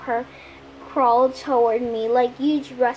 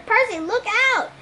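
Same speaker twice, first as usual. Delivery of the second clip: high urgent warning call; cried warning; 8 semitones higher than usual. A narrator's reading. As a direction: shout to save your friend. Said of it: "Annabeth cried".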